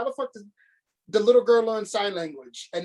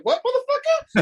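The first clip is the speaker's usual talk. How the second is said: much higher, louder laughing taunt; mock Godzilla dares Kong to come on; 4 dB louder than usual. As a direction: squeal the challenge while laughing.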